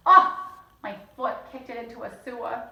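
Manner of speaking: usual speaking voice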